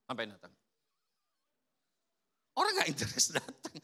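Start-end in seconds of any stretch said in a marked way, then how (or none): 0.1-0.5 low, soft, dismissive mutter; rude usher. Acted. Unfriendly usher greeting a returning visitor, low and cold. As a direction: brush the visitor off coldly.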